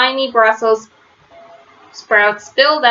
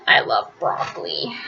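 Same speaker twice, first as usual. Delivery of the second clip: soft, breathless whisper to the listener; whispered aside; 6 semitones lower, 6 dB quieter than usual. Real, not acted. The reader whispers a secret aside to the listener. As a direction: confide it under your breath.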